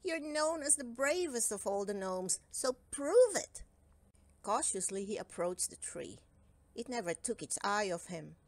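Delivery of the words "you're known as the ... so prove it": gnome scolding himself into courage, slowing on prove it; a firm pep talk to himself, building louder; self-scolding. A narrator's reading. Cowslip the gnome scolding himself to be brave, firm and rising. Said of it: "he scolded himself".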